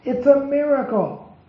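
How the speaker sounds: high-pitched cry of wonder; amazed exclamation. A narrator's reading. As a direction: exclaim it like a cowboy witnessing a miracle. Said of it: "and exclaimed".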